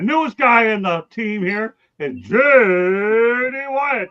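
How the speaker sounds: slow, high, loud ring-announcer build; Michael Buffer. Pretend. Host introducing the new guy, announcer style. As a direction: stretch the name like a fight intro. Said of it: "Do I sound like Michael Buffer?".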